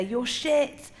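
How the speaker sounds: lightly voiced audience booing; imitated heckle. Audience heckling a comedian on stage, mock heckle. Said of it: "to boo you, to say".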